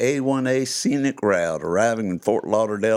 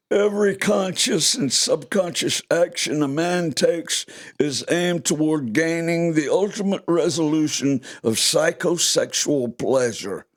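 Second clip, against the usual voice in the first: Sigmund Freud; 6 semitones higher than usual. Acted. Storyteller quoting the psychoanalyst on what drives a man, high role voice. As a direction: play the famous psychoanalyst, pitched well up. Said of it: "Like Sigmund Freud used to say".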